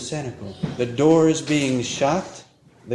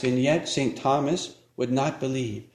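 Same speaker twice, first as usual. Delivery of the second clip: normal talking voice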